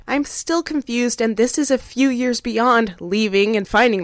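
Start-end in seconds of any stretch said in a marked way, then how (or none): none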